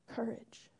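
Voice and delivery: a breathed, voiceless whisper. Real, not acted. Pastor's last whispered encouragement to the congregation, whisper. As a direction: let it barely escape. Final echo before prayer.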